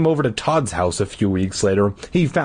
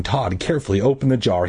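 ordinary delivery, nothing marked

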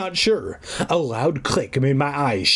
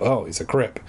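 normal talking voice

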